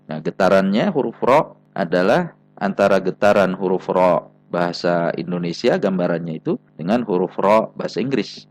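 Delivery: ordinary delivery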